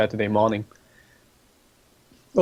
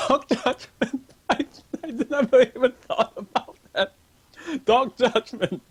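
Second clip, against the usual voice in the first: high, squeaky laughter through the words; real laughing; 12 semitones higher, slower than usual. Real, not acted. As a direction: crack up helplessly while talking. Cracking up at a misread word.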